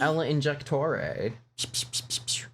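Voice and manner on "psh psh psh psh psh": injector hiss sound. Pretend.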